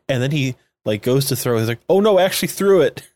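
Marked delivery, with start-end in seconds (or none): 1.9-3.1 higher, fast, louder mock panic; mock dismay. Man realizing he threw the ring, mock dismay. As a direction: blurt it in sudden regret. Retold guy regretting throwing ring.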